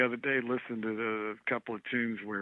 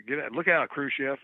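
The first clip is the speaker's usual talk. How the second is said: mild mock yell, punched louder; imitated yell; 3 semitones higher, 6 dB louder than usual. Musician quoting [name]'s shout from the record, mock yell. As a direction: call it out like a bandleader's joking warning. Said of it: "that's one where Doc yells".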